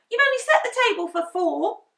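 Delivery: high, loud protest; indignant Henry. A narrator's reading. Henry complaining to Mum, high and loud. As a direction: protest like an outraged child. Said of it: "said Henry".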